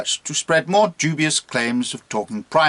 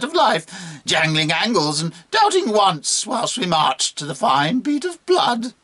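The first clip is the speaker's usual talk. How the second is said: high, theatrical monkey voice; mocking monkey; 6 semitones higher than usual. Monkey mocking the bishop as kin, gleefully scornful. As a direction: taunt in swooping, stretched-out phrases.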